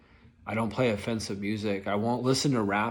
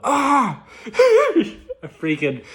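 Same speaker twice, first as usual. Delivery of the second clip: startled cry into laugh; 12 dB louder, much slower than usual. Real, not acted. Remembering rolling window on finger.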